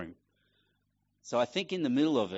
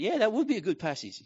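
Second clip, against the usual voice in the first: much higher, casual mock agreement; mock casual yeah higher up; self-dialogue; 10 semitones higher than usual. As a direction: echo the voice lightly, half convinced. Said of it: "And I went".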